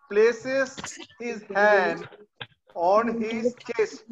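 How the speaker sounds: very slow, word by word reading; teacher reading aloud to the class; slow model reading. Shown as a demonstration. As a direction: read it out slowly so kids can follow. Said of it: "আমি পড়ে দেবো".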